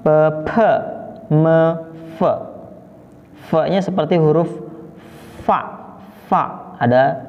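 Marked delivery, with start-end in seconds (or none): 0.0-2.3 "Pe, pe" without then with a puff, then "me", "fe"; spaced schwa syllables "Pe, pe, me, fe"; say-along sound drill. Shown as a demonstration. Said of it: "Kita ucapkan bersama-sama". 5.5-6.7 "fa" with air let out through the F; isolated, repeated "fa" showing the airflow; airy F sound. Shown as a demonstration. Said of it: "angin yang keluar".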